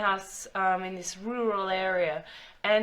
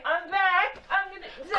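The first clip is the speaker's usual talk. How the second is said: calling out; 8 semitones higher, slower than usual. Pretend. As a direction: call out from out of sight. Calling out from off mic.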